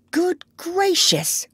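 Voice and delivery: high, slow amazement; high-pitched astonished doctor; astonished cry. A narrator's reading. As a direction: cry out in wonder, stretching it. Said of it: "cried the doctor".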